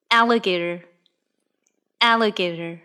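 ordinary delivery

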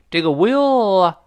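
ordinary talk, nothing marked

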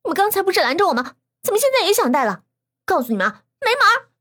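high, fast, indignant retort; snippy girl, loud and quick; curt refusal. A narrator's reading. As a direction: throw it back at them, then slam the door shut. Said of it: "栾婷婷生硬地拒绝他们".

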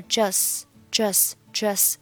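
just said slowly with no final t; just without t. Shown as a demonstration. Teacher drilling just without t. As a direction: drop the t of just. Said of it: "just末尾的t我是不发音".

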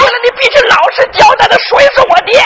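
high shouted demand for answers; angry interrogation. Acted. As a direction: grill mother at a high pitch. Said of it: "我当时我就恼了". (恼怒地高声逼供)

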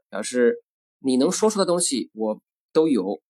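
usual speaking voice

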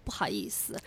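an embarrassed, unvoiced whisper; imitating a shy friend's whisper; whispered apology. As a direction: breathe it out shyly, barely audible.

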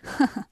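short, scornful "heh heh"; two dry, sneering chuckles; cold laugh. Acted. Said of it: "冷笑了两声".

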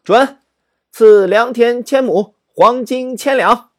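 high, loud and slow, granting with relish; the pleased emperor booms his reward; delighted royal grant. Acted. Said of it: "很高兴啊". (皇上高兴地大声恩准)

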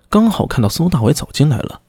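usual speaking voice

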